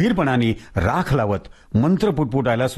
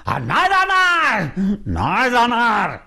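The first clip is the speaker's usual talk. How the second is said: much higher, slow defiant yell; ghost shouting; 8 semitones higher, slower than usual. Acted. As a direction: yell the refusal at him. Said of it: "भूत मोठ्यांदा ओरडून म्हणालं".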